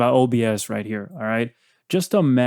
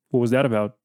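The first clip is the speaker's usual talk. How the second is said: ordinary talk, nothing marked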